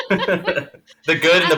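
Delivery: amused, laughing delivery. Real, not acted. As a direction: chuckle it out slowly. Laughing at rivalry remark.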